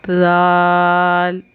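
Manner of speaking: जाल drawn out very slowly; letter demo. Shown as a demonstration. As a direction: hold जाल long for the class. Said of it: "देखिए".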